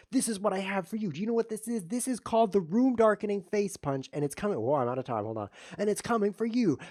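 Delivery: high, loud hammed-up menace; playful mock threat. Threatening the opponent with a face punch, mock menace. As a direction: taunt him with a silly threat.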